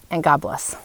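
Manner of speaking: ordinary talk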